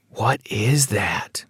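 slow, whispered awe; hushed wonder. Acted. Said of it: "asked Jack in wonder".

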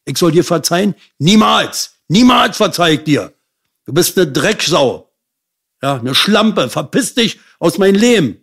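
high, loud, raging outburst, hurled at her; furious retold shouting. Son screams at his mother who asks forgiveness: furious high shouting. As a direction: scream it at her like years of rage breaking out.

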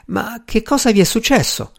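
high-pitched, worried question; alarmed concern. A narrator's reading. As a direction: ask in dismay what has happened. Doctor alarmed by stricken friend.

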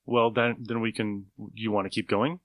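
ordinary talk

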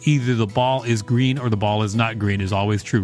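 ordinary talk, nothing marked

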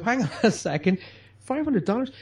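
double-take at the amount; incredulous. Retold or imitated. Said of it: "you think to yourself".

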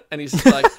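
usual speaking voice

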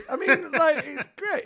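laughing loud through the words. Real, not acted. Praising The Fanatic to a friend, laughing loudly. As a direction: crack up while calling it great.

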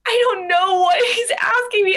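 high, loud, near-tearful wail; half-crying, at a loss; panicked whine. Retold or imitated. Panicked retelling, cry shout measured.

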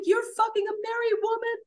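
high-pitched accusing shriek; furious screaming. Retold or imitated. Confronting partner over affair with married woman, screaming. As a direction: scream the accusation, stretching the last words.

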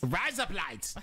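Australian accent. Shown as a demonstration. Showing off the razor blades trick, exaggerated Australian accent. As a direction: push the Australian accent further. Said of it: "razor blades in Australian".

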